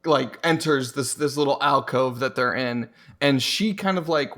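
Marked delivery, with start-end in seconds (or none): none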